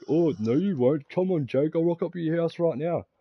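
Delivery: high, loud, slow sneering dare; mock the rival's cocky dare; mocking taunt. Tyson daring to come to the house, mocked high and loud. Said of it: "He's like".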